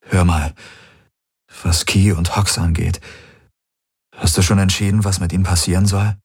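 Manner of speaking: ordinary delivery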